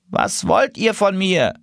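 a hint of a stern old goose, slowed; lead goose asking. Lead goose answering the rear geese, lightly voiced. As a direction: answer from the front, slow and measured. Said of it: "fragte die Führergans".

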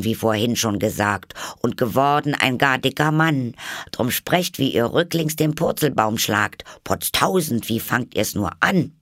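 put-on high, lively girl's recitation; poem recital. A narrator's reading. Alice recites the poem to the caterpillar. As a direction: recite it briskly like a schoolgirl.

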